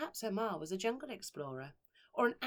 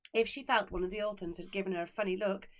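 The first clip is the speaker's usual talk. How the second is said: ordinary delivery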